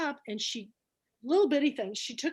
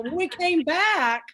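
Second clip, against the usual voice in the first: ordinary talk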